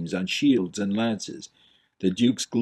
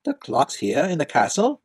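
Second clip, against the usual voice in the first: higher, rising question; questioning young prince; 5 semitones higher than usual. Acted. Prince questioning the Duke's demand. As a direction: ask in disbelief.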